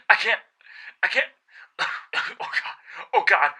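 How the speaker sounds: high, loud, gasping and breaking; dying diver choking out her last words; choking terror. Acted. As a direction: gasp it out between coughs in terror.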